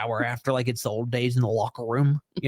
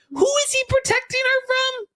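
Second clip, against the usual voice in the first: high squeaky question; mock baffled. Speaker retelling own confused thought, high squeak. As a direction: pitch way up in disbelief.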